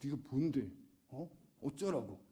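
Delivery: understated so-what attitude; brazen retort. Acted. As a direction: brush them off, lightly acting brazen. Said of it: "되레 뻔뻔하게".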